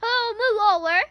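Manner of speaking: loud, impatient snarl. A narrator's reading. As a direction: push them aside with irritation. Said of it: "Hermione snarled".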